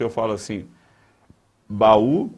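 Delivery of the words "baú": "baú" as ba-ú, loud, the ú its own syllable; separated vowels. Shown as a demonstration. Teacher demonstrating a hiatus, "baú" with a and ú kept apart. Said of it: "a separação de duas vogais".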